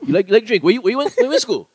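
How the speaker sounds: high, loud Hawaiian Pidgin questioning; Pidgin uptalk. Shown as a demonstration. Co-host chains pickup lines, high and loud Pidgin uptalk. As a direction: push each Pidgin line up like a question. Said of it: "everything's asking a question. You're always talking up".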